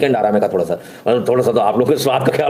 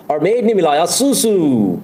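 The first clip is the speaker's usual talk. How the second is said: slow, higher, drawn-out reveal; mock dramatic reveal; 5 semitones higher, much slower than usual. Vlogger reveals the maid's trick, slow mock drama. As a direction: drag it out like a scandal.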